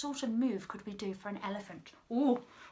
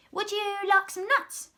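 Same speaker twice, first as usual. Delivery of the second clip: high, loud monkey voice; chirpy monkey; 12 semitones higher, 6 dB louder than usual. A narrator's reading. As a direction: pipe up with an eager offer. Monkey offers nuts to crocodile.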